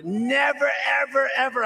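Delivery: loud, high insistent shout; emphatic never. Real, not acted. Rally crowd told Putin never would have, shouted insistence. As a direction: hammer each ever home.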